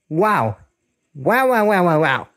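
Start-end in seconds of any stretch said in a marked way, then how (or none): none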